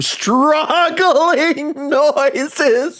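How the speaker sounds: words stretched high and slow through laughter; incredulous laughing. Real, not acted. Streamer reading an absurd game line, incredulous laughing. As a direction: break into a high-pitched laugh while reading.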